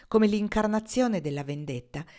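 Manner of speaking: ordinary delivery, nothing marked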